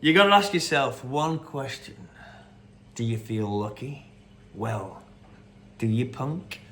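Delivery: mild hint of a squinting cop challenge; Clint Eastwood impression. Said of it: "but that was Clint Eastwood".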